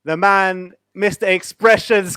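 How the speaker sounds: ordinary delivery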